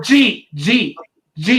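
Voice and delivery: "did you eat" squashed into "G", like jeet; Philly slurring. Shown as a demonstration. Host showing Philly slang to the panel, "G" said as jeet. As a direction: say "G" as one quick syllable, jeet. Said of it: "That's Philadelphia for".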